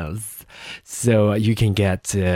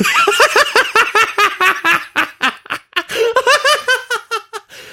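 shrill giggle at her own attempt; high squealing laugh; 5 dB louder than usual. Real, not acted. Laughing at her own tax, taxes try, high squealing laugh.

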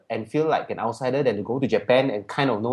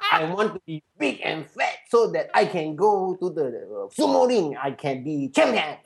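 hammed-up Japanese-accented broken English, higher pitched; mock Japanese accent; 3 semitones higher than usual. Student fooling a lady as a sumo wrestler, mock Japanese accent.

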